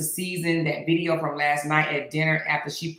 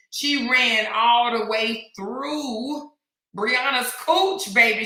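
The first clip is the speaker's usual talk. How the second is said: stretched, slow teasing; 4 semitones higher, slower than usual. Real, not acted. Teasing about Jada, slow and drawn out. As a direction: draw each word out slowly.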